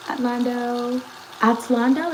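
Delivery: Atlando said twice, testing the sound; trying the name. Shown as a demonstration. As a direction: Atlando with a rising question. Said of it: "is that how you say it".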